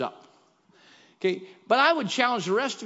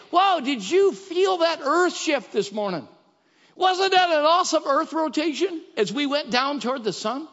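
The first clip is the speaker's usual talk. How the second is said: mock gushing; 7 semitones higher, 4 dB louder than usual. Mocking gushing churchgoers.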